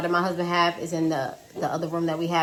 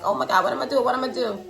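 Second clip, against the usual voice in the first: higher, fretful replay of panicking; mild retold panic; 5 semitones higher, faster than usual. Learner driver retelling her dilemma, mildly panicked. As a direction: imitate yourself fretting over what to do.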